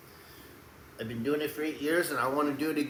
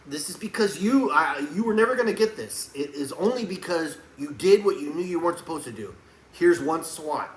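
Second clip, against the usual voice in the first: loud, higher stern father voice; 3 semitones higher, 5 dB louder than usual. Retold or imitated. Telling his child to bend over for a spanking, as a stern father. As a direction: order the child like a strict dad. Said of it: "I will tell him".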